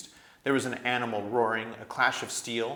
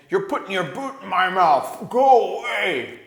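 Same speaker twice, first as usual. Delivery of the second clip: loud, high pained dwarf bellow; dwarf bellowing from under the pile; 9 semitones higher, 12 dB louder than usual. A narrator's reading. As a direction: bellow it out in pain. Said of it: "bellowed Trumpkin".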